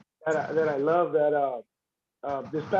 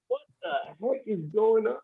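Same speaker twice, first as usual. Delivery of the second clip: slow, drawn-out mock bewilderment; retold disbelief; 3 semitones higher than usual. Retelling how a twelve-year-old beat him, in slow disbelief. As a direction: retell it slowly, as if baffled. Retelling shock at losing to kid.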